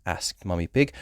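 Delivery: usual speaking voice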